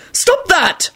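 high-pitched angry command; irate, high-pitched scolding; wrathful holler. A narrator's reading. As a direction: holler it crossly. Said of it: "Neville hollered wrathfully".

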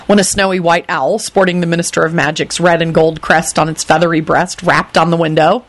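plain reading voice

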